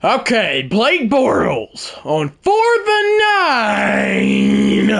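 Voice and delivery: excited, high shouted sports commentary; play-by-play announcer. Pretend. Streamer commentating his own football game, shouted play-by-play. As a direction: call it like the winning play.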